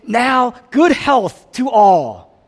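high, booming, drawn-out announcement; mock proclamation. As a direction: trumpet it slowly and loudly. Mimics a proclamation nobody makes.

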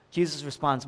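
ordinary talk, nothing marked

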